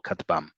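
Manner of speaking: low-pitched katbam, weight on final "bam"; last-syllable stress. Shown as a demonstration. Teacher giving a clue to emphasis, "katbam" stressed on its last syllable. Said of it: "the emphasis is on the last syllable".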